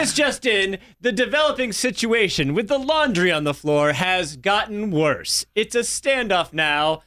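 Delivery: hammed-up breaking news read; high, serious anchor delivery. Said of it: "that anchor voice".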